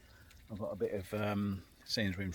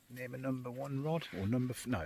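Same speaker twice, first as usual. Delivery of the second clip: usual speaking voice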